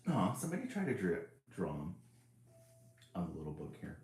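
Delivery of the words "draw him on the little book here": plain reading voice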